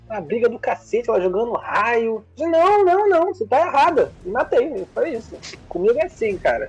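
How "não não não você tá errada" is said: high, loud heated retort; retold shout. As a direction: yell it like mid-fight in the game. Retelling his fight in the game. (grito indignado relembrado)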